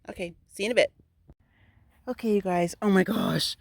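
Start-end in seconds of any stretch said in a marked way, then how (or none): none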